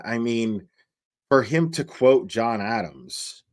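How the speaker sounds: ordinary delivery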